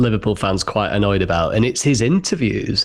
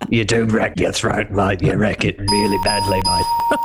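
Ange Postecoglou; 3 semitones lower than usual. Retold or imitated. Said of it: "doing Ange Postecoglou".

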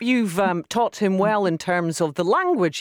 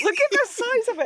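amused high squeal. Real, not acted. Teasing a colleague about a tiny Viking helmet, high amused squeak. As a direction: pitch jumps way up in mock disbelief.

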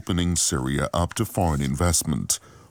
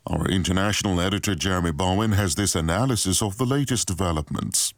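news read; 2 semitones higher than usual. Acted.

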